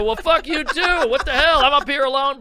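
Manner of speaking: comic on stage losing it at the back row; retold angry yell. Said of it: "So I go".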